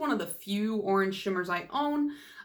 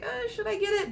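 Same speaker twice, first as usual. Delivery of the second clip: much higher, unsure little question to herself; second-guessing a purchase, pitched way up; hesitant self-doubt; 13 semitones higher than usual. Retold or imitated. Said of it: "So I was kinda like".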